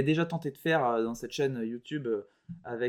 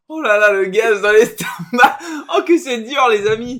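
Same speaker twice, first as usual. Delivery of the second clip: laughing strain; 7 semitones higher, 11 dB louder than usual. Real, not acted. Holding in gas, laughing.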